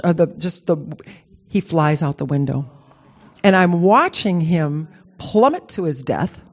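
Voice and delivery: ordinary talk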